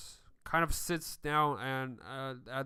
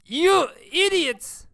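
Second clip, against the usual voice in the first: much higher, louder slow yell of despair; exasperated yell; 13 dB louder, slower than usual. Acted. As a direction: yell it out in disbelief. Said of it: "the leader just facepalm like".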